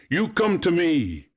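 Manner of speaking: high, ringing summons; shouting. A narrator's reading. Narcissus summons the unseen voice, shouting high. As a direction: call them over from afar. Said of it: "he shouted".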